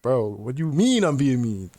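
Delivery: retold incredulous comeback, swooping up; incredulous protest. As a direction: protest as if baffled by the accusation. Retold protest at being called mean.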